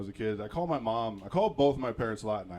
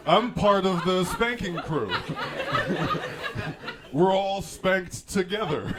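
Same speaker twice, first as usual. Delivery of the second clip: put-on slow, louder, higher kid's boast; mock proud boast; 6 semitones higher, 5 dB louder, slower than usual. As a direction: proudly announce membership, drawn out. Boasting as kid joining the group.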